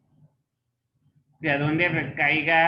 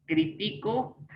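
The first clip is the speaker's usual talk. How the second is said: "critico" as cri-TI-co; stress on the middle syllable; 4 semitones higher, slower than usual. Shown as a demonstration. Teacher showing the form without accent, "critico" stressed on TI. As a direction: lean on TI in "critico". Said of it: "no lleva critico porque no le estoy poniendo acento".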